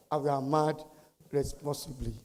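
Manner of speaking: subdued restatement. Real, not acted. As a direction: let the volume drop away to a quiet close. Quieter repeat of the slogan.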